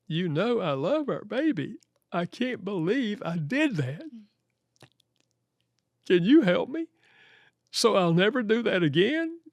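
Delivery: mildly acted tearful apology; retold tearful plea. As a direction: imitate a sorry husband begging for help. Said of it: "walked in and started crying".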